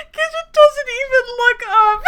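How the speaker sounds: high, loud, helpless laughter through the words; laughing hard. Real, not acted. Reader losing it after the posh waiter line, laughing hard. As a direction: read on while cracking up. Reader cracking up while reading.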